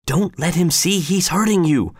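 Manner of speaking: ordinary delivery, nothing marked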